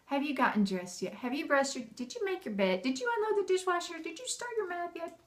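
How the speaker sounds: acted mom nag, rising as it goes; nagging mom. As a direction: rattle off reminders with climbing pitch. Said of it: "Don't wait for Mom to go".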